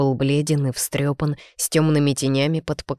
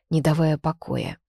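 ordinary delivery

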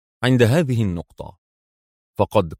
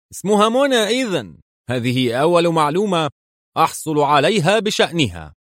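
higher-pitched sly satisfaction; cunning; 6 semitones higher than usual. A narrator's reading. General learns the captive's name, cunning. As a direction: pitch it up, knowingly. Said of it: "ابتسم ياكوف في دهاء".

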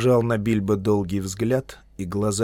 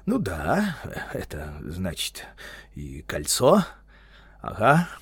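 halting, uneven answer with pitch jumps; flustered, faltering hobbit; hesitant stammer; 2 semitones lower, slower than usual. A narrator's reading. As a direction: stumble through it, stalling between words. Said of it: "начал заикаться Бильбо".

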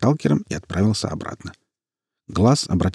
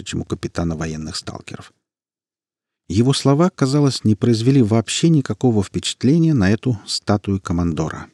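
ordinary delivery, nothing marked

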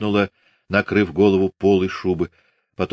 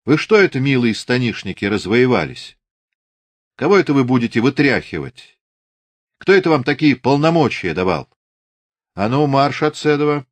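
raised, commanding reprimand; indignant rebuke; 6 semitones higher, 3 dB louder than usual. A narrator's reading. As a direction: tell off unruly soldiers and order them out. Officer rebukes intruding Cossacks, orders them out.

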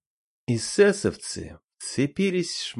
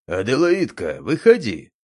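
loud call; 6 dB louder than usual. A narrator's reading. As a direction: call her out, louder. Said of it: "позвал Бурцев".

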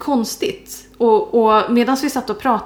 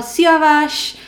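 high, loud re-enacted call; 8 semitones higher, 5 dB louder than usual. Calling a colleague standing in the street, re-enacted shout. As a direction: call after someone, pitched up high. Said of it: "jag ropar efter honom".

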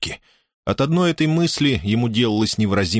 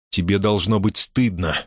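ordinary delivery, nothing marked

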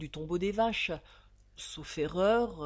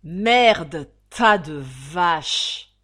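much louder, dragged-out howl of helpless fury; howled in rage; 14 dB louder, much slower than usual. Acted. Locked-in prisoner howls curses at the guards in impotent rage. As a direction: scream the curse from the bottom of the pit. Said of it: "il hurla au fond du trou".

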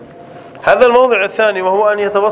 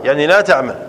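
ordinary talk, nothing marked

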